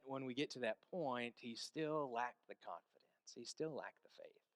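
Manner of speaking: ordinary talk, nothing marked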